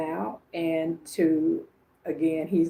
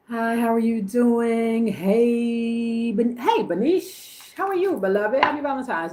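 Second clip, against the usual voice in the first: excited greeting; 6 semitones higher, 5 dB louder than usual. Real, not acted. Greeting a viewer on live.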